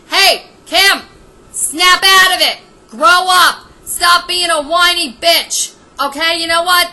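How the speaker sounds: loud, fed-up scolding; angry scolding. Real, not acted. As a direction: snap her out of it, loud. Ranting at Kim directly.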